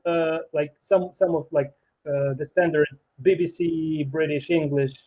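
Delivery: ordinary talk, nothing marked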